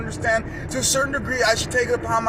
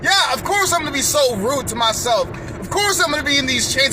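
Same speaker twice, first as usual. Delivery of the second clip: ordinary talk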